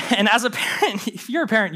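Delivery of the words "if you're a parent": usual speaking voice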